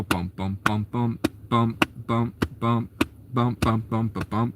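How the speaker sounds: soft rhythmic drum-beat bums, imitating a country rhythm; drum beat mouthed. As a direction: tap out the beat with your voice.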